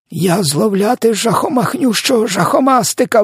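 very high-pitched outcry; distressed exclamation. A narrator's reading. Giant tells Sophia what he caught, high horrified cry. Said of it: "вигукнув він".

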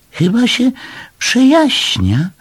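very high, squeaky creature voice; little animal. A narrator's reading. Little animal distracting others from his shame, high squeaky voice. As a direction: chirp it high and drawn out.

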